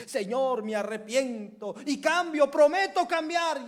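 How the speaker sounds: high, loud begging promise; desperate plea. Acted. Sinner begs the Lord too late, loud and desperate. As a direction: plead to the Lord at full voice.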